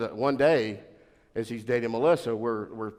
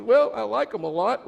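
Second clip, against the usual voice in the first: higher, louder mock girlish voice; daughter's voice; 12 semitones higher, 7 dB louder than usual. Daughter defending her boyfriend to dad, high girlish voice. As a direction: daughter insisting, pitched up.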